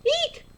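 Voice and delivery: very high squealing "Eek"; shrill mock fright squeal; excited squeal. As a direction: squeal like you just saw a snake. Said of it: "I'm excited when I say".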